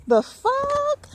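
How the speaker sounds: what on earth, outraged surprise; incredulous. Real, not acted. Reacting to the authorities' orders.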